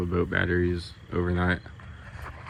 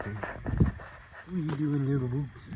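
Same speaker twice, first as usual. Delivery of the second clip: higher, slow sing-song to animals; pet talk; 6 semitones higher, 7 dB quieter, slower than usual. Real, not acted. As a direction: coo at the little sheep. Talking to the sheep.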